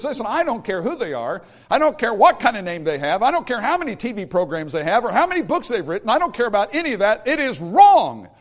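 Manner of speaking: fiery preacher's rebuke; full-force preaching. Acted.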